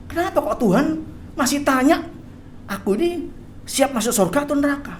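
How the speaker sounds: high, puzzled questioning; startled. Acted. Church worker asking why God still questions him, bewildered. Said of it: "Orang ini kaget".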